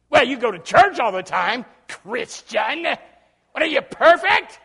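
loud, high sneering challenge; taunting coworker. Retold or imitated. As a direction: jeer at the churchgoer.

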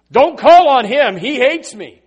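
loud, high, slow King Ahab whine; King Ahab crying out against Micaiah; Ahab complaining. Acted. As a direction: protest like a sulky king.

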